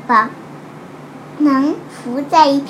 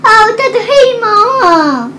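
excited; 7 semitones higher, 10 dB louder, much faster than usual. Real, not acted. Child excited at picture.

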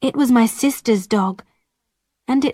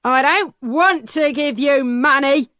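peddler refusing; 4 semitones higher than usual. A narrator's reading. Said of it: "the peddler said".

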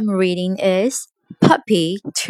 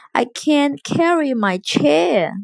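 slow, higher-pitched little complaint; mild wail; 8 semitones higher, slower than usual. A narrator's reading. Puppy who can't pull the toy box, mildly wailing. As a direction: complain slowly with a touch of wail. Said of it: "wailed the puppy".

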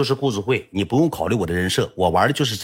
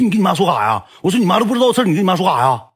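higher, louder, fast accusing; confronting the boy who told his mom, raised voice; mock angry confronting; 9 semitones higher, 4 dB louder, faster than usual. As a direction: demand it like a kid caught out.

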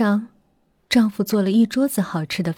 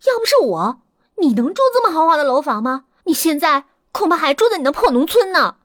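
higher, scornful and rising; contemptuous anger; 7 semitones higher than usual. Acted. Wife scorns her husband's village roots, angry. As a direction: sneer at where he came from.

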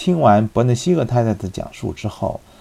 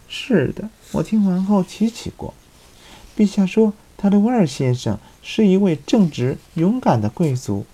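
higher, light female voice; young woman voice; 10 semitones higher than usual. Acted. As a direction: speak as a gentle young lady. Woman character answering. (轻柔的年轻女声)

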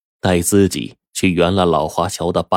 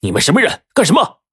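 alarmed high-pitched shouted challenge; loud, urgent confrontation; loud demand; 12 semitones higher, 5 dB louder, faster than usual. A narrator's reading. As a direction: shout it sharply, voice leaping up. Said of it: "大声地问道".

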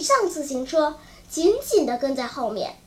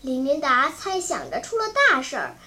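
ordinary delivery, nothing marked

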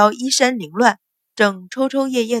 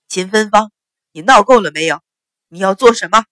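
very loud, furious rebuke of his wife; husband yelling at his wife in rage; angry shout; 9 dB louder than usual. A narrator's reading. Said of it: "大声喝道".

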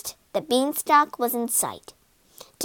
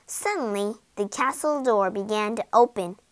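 plain reading voice

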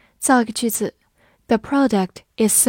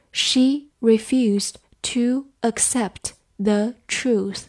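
slow and separated, word by word; slow reading; much slower than usual. Shown as a demonstration. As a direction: read it slowly for learners. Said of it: "我们慢读一遍".

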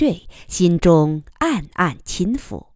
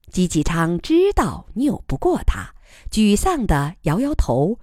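ordinary delivery, nothing marked

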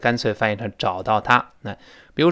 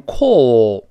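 British vowel in CALL, rounded lips; 2 semitones lower, 4 dB louder than usual. Shown as a demonstration. Teacher contrasts accents, CALL with British pouted vowel. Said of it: "英国人会读成call".

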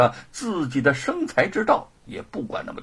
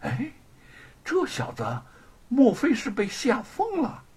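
high, wondering aside; baffled, suspicious musing; puzzled alarm; 6 semitones higher, 3 dB quieter than usual. Acted. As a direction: think aloud in startled doubt. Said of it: "狱卒惊疑地想".

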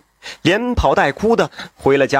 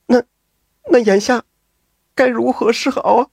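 higher, faltering, scared woman's voice; frightened woman; 7 semitones higher than usual. A narrator's reading. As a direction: stammer it out in fright, pitched up. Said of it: "这潘氏是吓得流出了眼泪".

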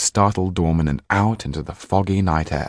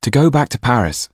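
put-on higher, louder boy's voice, determined; Jan's voice; 6 semitones higher, 5 dB louder than usual. A narrator's reading. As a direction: voice the boy, set on leaving. Jan voiced in story.

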